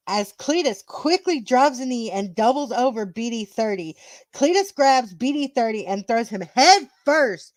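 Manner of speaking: emphatic match storytelling; wrestling commentary. Acted. Narrator telling the title match to co-hosts, commentary style. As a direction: punch the key words of the action.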